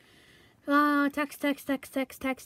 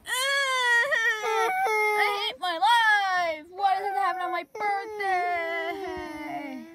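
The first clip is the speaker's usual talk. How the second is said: hammed-up slow wailing, higher; mock crying; 5 semitones higher, much slower than usual. Castaway wailing that the crash ruined their birthday. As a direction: whine it like a sulky kid.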